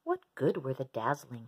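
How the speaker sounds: usual speaking voice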